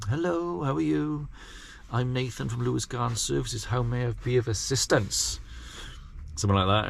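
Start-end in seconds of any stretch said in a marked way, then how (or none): 0.1-5.4 softer, prim and formal, a bit nervous; overly polite salesman. Retold or imitated. New gardener greeting a customer at the door, softer and stiffly polite. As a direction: put on your best doorstep manners, quietly. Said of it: "Used to go there, the little portfolio".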